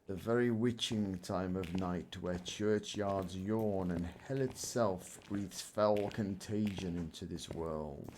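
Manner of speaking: low, slow, brooding verse; gothic Shakespeare recitation. Pretend. Player quoting Shakespeare in a spooky game, gothic recitation. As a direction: recite it softly and ominously, drawing out the words. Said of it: "Quoting Macbeth like a dirty old goth".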